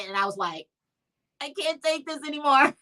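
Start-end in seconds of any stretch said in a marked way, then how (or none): 1.4-2.8 fake distress. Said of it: "acting skills".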